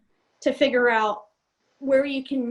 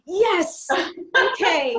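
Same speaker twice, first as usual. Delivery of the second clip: louder, higher cry of vindication; triumphant relief; 5 semitones higher, 5 dB louder, much slower than usual. Retold or imitated. Pilot hearing official confirmation she was right, triumphant. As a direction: punch it like you've just been proven right. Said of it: "I was like".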